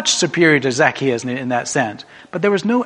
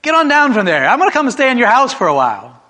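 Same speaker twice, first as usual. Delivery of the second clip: calling up the tree; 7 semitones higher, 7 dB louder than usual. Acted. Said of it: "looks up at him and says".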